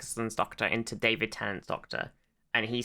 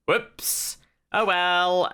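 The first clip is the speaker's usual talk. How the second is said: breathy higher shrug of a whoops; mock careless Doctor; 6 semitones higher, 4 dB louder, much slower than usual. Imitating the Doctor brushing off a lost year. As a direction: toss it off carelessly. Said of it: "And the doctor's like".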